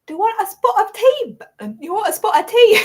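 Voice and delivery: high, loud mock-British kid; hammed-up British tea invitation; British accent. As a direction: imitate a child putting on a British accent. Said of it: "a British accent".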